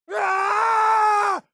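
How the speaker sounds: sudden sharp high cry of pain; a short startled scream; pained shout. Acted. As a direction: yelp as if struck.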